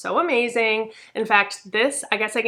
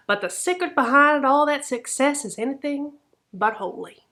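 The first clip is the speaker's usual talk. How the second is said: mock Southern accent; 5 semitones higher than usual. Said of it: "envisioning this with an accent".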